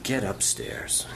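tender push toward the stairs; soft command. Retold or imitated. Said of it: "and said".

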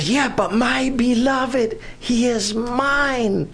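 a touch of a smitten bride; dreamy girl. Retold or imitated. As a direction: linger on it like a girl in love. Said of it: "She said".

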